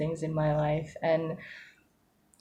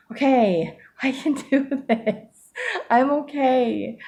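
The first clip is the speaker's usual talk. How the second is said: relieved self-talk; 7 semitones higher, slower than usual. Retold or imitated. She reassures herself after a hard day, high and slow. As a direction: tell yourself gently it's fine.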